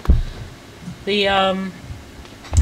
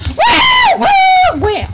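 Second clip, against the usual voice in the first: excited whooping; 8 dB louder, much slower than usual. Real, not acted. Excited yelling in game.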